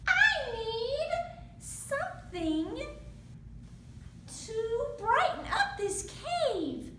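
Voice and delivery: high, bright little mouse voice. Acted. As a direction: speak as a tiny mouse in the dark. Mouse speaks in story.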